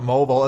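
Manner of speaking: "mobile" as MO-bul; mistaken pronunciation. Shown as a demonstration. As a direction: rhyme "mobile" with noble. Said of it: "So I've been pronouncing it".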